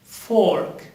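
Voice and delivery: "Fork" with the R sounded from the throat; throaty R. Shown as a demonstration. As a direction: pronounce the R in "Fork" in your throat. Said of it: "You pronounce the R with your throat".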